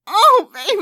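loud crying out; climaxing. Acted. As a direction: call out loudly at the peak. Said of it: "I'm coming".

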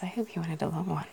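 ordinary talk

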